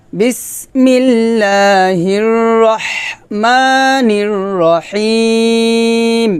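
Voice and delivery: slow chanted recitation; Quran recitation. Shown as a demonstration. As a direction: draw each word out in a recitation tune. Full verse recited.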